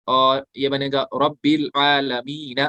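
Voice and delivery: slow, drawn-out Quranic recitation; recitation demo. Shown as a demonstration. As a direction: stretch रब्बिल आलमीन slowly, one alif on the या. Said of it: "एक अलिफ खींचेंगे".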